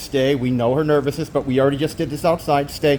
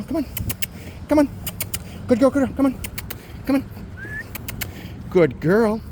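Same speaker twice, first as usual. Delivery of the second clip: usual speaking voice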